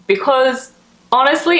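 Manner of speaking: usual speaking voice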